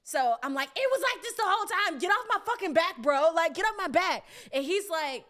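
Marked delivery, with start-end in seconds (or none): 0.8-4.2 much higher and louder defiant yell; mock argument. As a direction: shout back like a bratty kid sister. Arguing with big brother.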